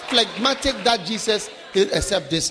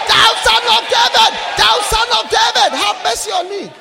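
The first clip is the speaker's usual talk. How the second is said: loud, high desperate shout; crying out; 7 semitones higher, 6 dB louder than usual. Acted. Blind Bartimaeus crying out to Jesus, loud high shout. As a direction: cry out for mercy at full force. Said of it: "He started shouting".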